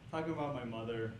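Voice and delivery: ordinary talk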